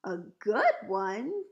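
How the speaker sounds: drawn-out, loud exclamation; slow and loud, amazed at the question; incredulous cry. A narrator's reading. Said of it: "he cried".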